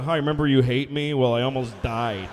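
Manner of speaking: low, loud, awkward phone-call voice; divorced caller. Retold or imitated. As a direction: act out a sheepish call to an ex.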